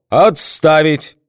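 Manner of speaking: booming parade-ground shout, high and loud; thunderous command bark. A narrator's reading. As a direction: bark the orders like a colonel. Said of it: "громовым командным голосом рявкнул".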